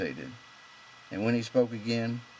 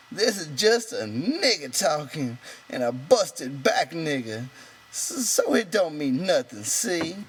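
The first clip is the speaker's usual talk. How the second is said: high, louder and slow, pressing; excited; 8 semitones higher, 6 dB louder, slower than usual. A narrator's reading. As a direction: lean in, urgently insisting. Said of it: "He leaned forward excitedly".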